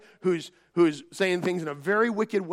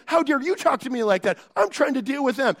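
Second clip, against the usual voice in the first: indignant husband; 6 semitones higher, 4 dB louder than usual. Retold or imitated. Husband snaps back at wife.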